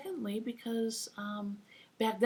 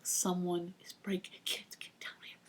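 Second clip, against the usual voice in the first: put-on hushed, frightened call to the kids; urgent whisper; 6 dB quieter than usual. Parents whispering to kids during a break-in.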